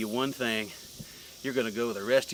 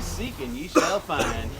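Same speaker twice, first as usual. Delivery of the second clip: slow menace; 2 semitones higher, slower than usual. Acted.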